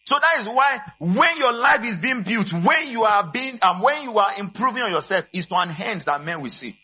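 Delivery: fired-up sermon voice; full-force preaching. Acted. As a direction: preach it out, rising to the back row.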